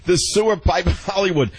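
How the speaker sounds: delighted laughing. Real, not acted. Host laughing repeats phrase.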